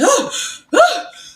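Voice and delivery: sharp, loud waking gasp; startled gasp. Retold or imitated. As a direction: gasp as if jolted from a nightmare. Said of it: "woke up out my sleep sweating".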